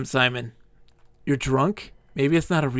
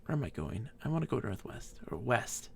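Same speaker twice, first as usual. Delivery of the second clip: quiet; 4 semitones lower, 13 dB quieter than usual. Real, not acted. Quiet drunk muttering.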